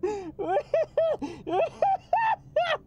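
very high squealing laugh; hysterical laughter. Real, not acted. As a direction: burst into uncontrollable high laughter. Bursts out at surprise cameo.

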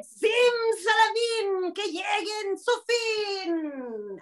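high, slowly chanted incantation; witch's spell. Acted. Storyteller leading children in the witch's curse, drawn-out chant. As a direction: stretch the spell out long and high. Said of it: "A ver, ayúdenme".

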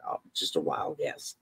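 hushed, low throwaway dodge; evasive whisper. Retold or imitated. Deflecting curious people, a low hushed brush-off. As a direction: whisper it off as nothing.

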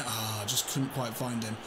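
usual speaking voice

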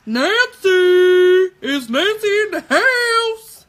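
loud, drawn-out call to the crowd. Pretend. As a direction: hype her up like a show host. Calling out to viewer.

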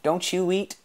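high, impatient question; snappish. A narrator's reading. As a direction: snap the question back. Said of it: "snapped Logan".

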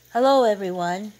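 loud host's hello; shouted greeting. A narrator's reading. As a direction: call out to the whole room. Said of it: "Yuan shouted".

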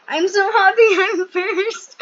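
high, loud, slow, laughing through the words; overjoyed laughing winner cry; ecstatic winner. Pretend. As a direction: squeal out the victory with a laugh.

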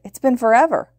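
high, loud happy exclamation; cheering. A narrator's reading. Mei greets Zuku after forever apart, cheering. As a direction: burst out happily to an old friend. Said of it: "Mei cheered".

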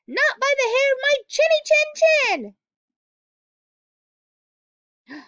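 high, loud little pig voice; a little pig yelling back at the wolf from behind the door; little pig crying out. Acted. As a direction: squeal it defiantly like a tiny pig. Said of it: "cried the first little pig".

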